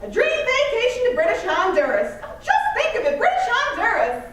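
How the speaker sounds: high, loud game show host voice; booming prize announcer. Acted. Mock game show prize reveal.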